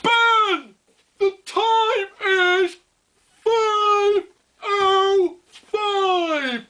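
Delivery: grand herald booming the time, drawn out; loud and clear. Pretend. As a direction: proclaim it like a booming digital herald. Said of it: "they'll be like".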